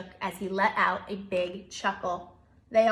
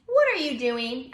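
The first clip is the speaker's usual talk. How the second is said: curious hedgehog; 3 semitones higher, 7 dB louder than usual. Acted. Hedgehog asks Bear.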